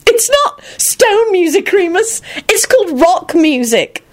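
very high, drawn-out mirthful squeal; laughing correction. A narrator's reading. Elizabeth laughing at Remus's mistake, high amused voice. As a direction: correct him slowly through high-pitched mirth.

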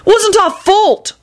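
very high, drawn-out protest; yelling. A narrator's reading. Culprit denying blame to the boss, high stretched yell. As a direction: stretch the words into an indignant whine-yell. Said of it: "Neville yelled".

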